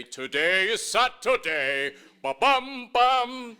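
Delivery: slow, loud, high singsong bellow; father's wake-up call. Retold or imitated. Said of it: "he would wake us up".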